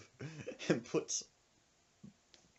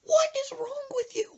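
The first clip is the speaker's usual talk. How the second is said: high whispered disbelief; high whispered outrage; exasperated. Retold or imitated. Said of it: "I was like".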